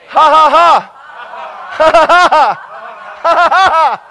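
loud, deliberate laughing bursts; yielding to joy. Shown as a demonstration. Preacher yielding to joy by faith, loud laughter. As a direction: let out big forced belly laughs. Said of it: "I yield to joy by faith".

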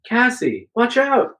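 high-pitched urgent warning cry; screamed warning. A narrator's reading. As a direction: cry out high to warn a friend. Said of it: "you scream".

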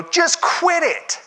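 mock harsh just quit it; dismissive snap. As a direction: bark it at a suffering friend. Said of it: "and go".

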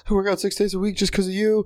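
high-pitched starstruck fan voice; gushing fan. Retold or imitated. Weightlifting fan gushes to his idol, high voice. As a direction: gush to your idol in a put-on high voice. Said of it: "I really wanted to be like".

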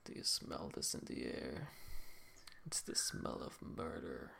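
very low, whispery detective voice; Detective Train. Acted. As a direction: hush it right down, slowing ominously into the murder. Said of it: "that's how Detective Train sounds like".

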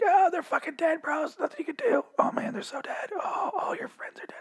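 mock the dying guy in a squeaky falsetto. Vlogger imitating a dying prisoner, squeaky falsetto whine. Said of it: "He wasn't that high pitched".

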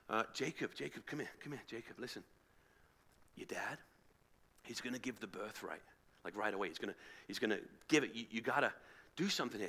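very soft, low, secretive urging; conspiratorial hush. Acted. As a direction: pull someone aside to plot quietly. Rebecca secretly calls Jacob to plot.

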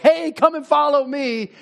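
a careless call to nobody in particular; generic call. Pretend. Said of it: "not some abstract, amorphous, like".

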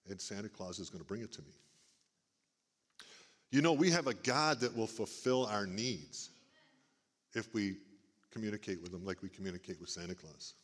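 usual speaking voice

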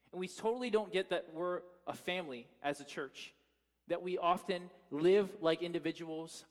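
rising preacher's appeal; fervent preaching. Acted. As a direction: preach it out to the congregation with urgency.

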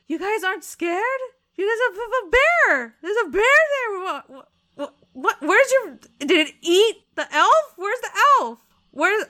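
high, loud, panicked disbelief; flabbergasted. Acted. Onik asking the party where their elf went, flabbergasted. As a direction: yelp in total bewilderment. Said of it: "He's just flabbergasted".

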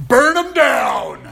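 high, loud, drawn-out yell; mock road rage. Driver to whoever cut them off in traffic, mock-furious yell. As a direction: bellow it slowly like a comically vengeful driver.

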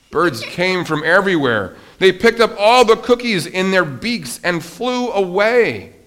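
high, loud narration building up; story reading. A narrator's reading. Teacher reads Frog's big moment to children, rousing storytelling. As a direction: build excitement towards the shout.

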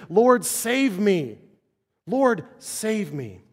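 slow, high, drawn-out cry for help; urgent plea. Acted. The call all must make, urgent plea. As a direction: stretch each word as a heartfelt plea. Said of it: "This is the call".

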